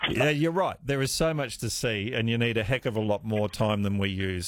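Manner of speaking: ordinary talk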